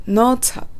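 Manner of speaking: "not at" run together like "no-tsat"; linked, strong T. Shown as a demonstration. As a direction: link "not at" through a strong, ts-like T. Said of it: "と発音しましょ". (「not at」を「ノーツァッ」のようにつなげて)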